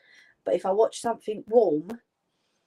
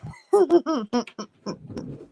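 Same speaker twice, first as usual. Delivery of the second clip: laughing; 7 semitones higher, 5 dB louder than usual. Real, not acted. Laughs at frogs comment.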